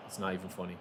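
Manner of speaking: usual speaking voice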